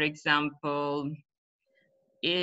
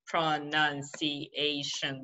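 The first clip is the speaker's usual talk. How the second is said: pro-nun-ci-a-tion in slow separate beats; syllable by syllable; 3 dB quieter, much slower than usual. Shown as a demonstration. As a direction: slowly tap out each syllable. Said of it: "they try to tap it".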